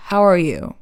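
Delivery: "How are you?" falling away at the end; level English "How are you?" with a falling "you"; flat English ending. Shown as a demonstration. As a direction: let "you" drop, no lift. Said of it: "it could be a little flat at the end".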